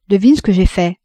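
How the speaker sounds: ordinary delivery, nothing marked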